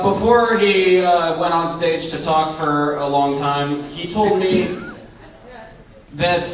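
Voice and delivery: ordinary delivery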